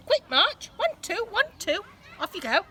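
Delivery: high, loud mock marching orders; sergeant-major drill call. A narrator's reading. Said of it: "dad drilling kids like troops".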